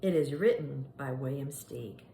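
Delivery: plain reading voice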